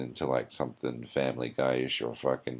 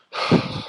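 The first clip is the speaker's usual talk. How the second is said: exasperated sigh; 6 dB louder than usual. Real, not acted. Exasperated at the show.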